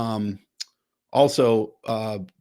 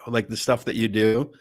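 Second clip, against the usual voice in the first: ordinary delivery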